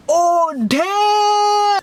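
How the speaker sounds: high mock shout of oh damn; imitating shocked shout. The brothers reacting to the burn, a high shocked shout. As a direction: mimic the room's stunned outcry. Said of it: "their friends were like".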